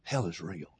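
very soft, low realization; awed thought. Retold or imitated. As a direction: drop low and quiet, as if thinking it. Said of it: "I started thinking".

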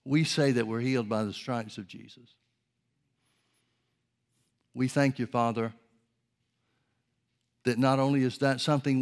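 earnest praying aloud to God; fervent prayer. Acted. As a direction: pray it with conviction. Prayer continues.

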